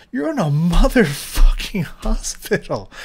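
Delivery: loud, slow, angry restatement. Real, not acted. Ranting about hospital negligence, loud and slow.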